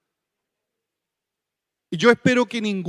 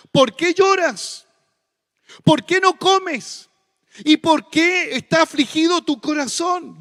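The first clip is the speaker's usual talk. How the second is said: higher, slower, imploring husband; tone of mercy; 6 semitones higher, 5 dB louder than usual. Retold or imitated. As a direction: plead tenderly with his weeping wife. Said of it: "con un tono de mucha misericordia".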